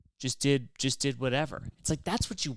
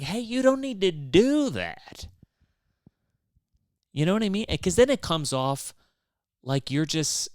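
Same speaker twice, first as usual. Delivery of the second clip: louder and higher, exasperated; raised exasperated voice; 7 semitones higher, 5 dB louder than usual. Retold or imitated. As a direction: tell him off, exasperated. Telling friend off, raised.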